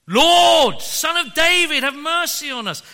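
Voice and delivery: loud, high, almost screaming plea. Retold or imitated. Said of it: "They shouted even louder".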